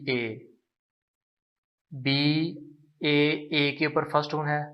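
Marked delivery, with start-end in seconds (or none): none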